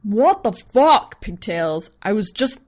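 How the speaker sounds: loud, slow outraged bellow. Acted. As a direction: roar it after being hit. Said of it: "he begins to bellow".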